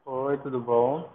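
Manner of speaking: ordinary delivery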